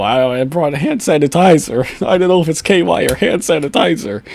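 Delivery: mocking whiny suspect. Vlogger mocking the suspect's excuse, whiny and sneering. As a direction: mimic his lame excuse with scorn.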